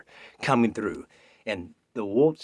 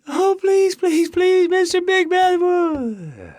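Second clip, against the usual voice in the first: mock pleading girl; 13 dB louder, slower than usual. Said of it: "she's like".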